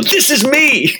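high, laughing proud announcement; laughing excitedly. Retold or imitated. Showing the cashier his photo, high and laughing. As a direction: squeal it through laughter. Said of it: "I go".

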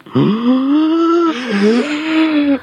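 shocked high gasp; loud high gasp. Acted. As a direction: gasp loudly in horror. Shocked at cockroach.

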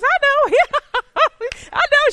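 very high, loud, giggly agreement; hammed-up laughing through the words; imitated laughing friend. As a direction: squeal it out, mimicking a friend who laughs everything off. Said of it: "she just laughing and joking".

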